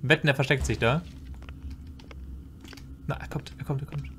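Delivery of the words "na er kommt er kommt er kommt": much softer, hushed warning; tense whisper. Real, not acted. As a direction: breathe it out so he cannot hear. Hiding from approaching monster.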